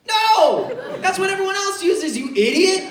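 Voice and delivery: high, loud comic scolding; exasperated shout. Retold or imitated. Cop's partner berating friend's wrong answer, exasperated yell. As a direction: yell it at a fool.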